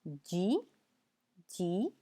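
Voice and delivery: teacher demo of जी; French letter J. Shown as a demonstration. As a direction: model जी for J. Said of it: "जे को बोलते हैं".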